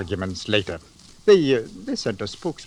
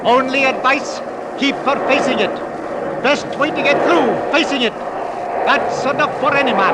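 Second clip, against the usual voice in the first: high, loud shouted orders; captain yelling over the wind; shouting over storm; 12 semitones higher, 6 dB louder than usual. Acted. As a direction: bellow over the gale.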